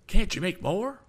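loud, high-pitched plea; high alarmed demand; anxious cry. A narrator's reading. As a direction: cry out the question in panic. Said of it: "cried Mister Damon".

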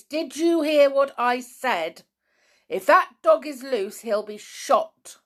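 angry ultimatum, called out loudly; loud threat. Acted. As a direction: threaten someone hiding down a tunnel. Armed man threatens hidden intruder.

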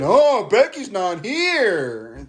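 high, slow mocking whine; whiny mock promo. Podcaster mocks Charlotte's promo, slow high whine. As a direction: stretch it out like a sulky champion.

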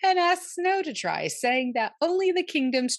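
laughing. Real, not acted. Narrating while laughing.

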